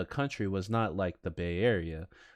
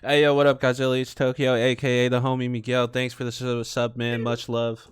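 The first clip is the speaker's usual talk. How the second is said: hype shout-out; 4 semitones higher, 9 dB louder than usual. Real, not acted. Shout-out to chat donor.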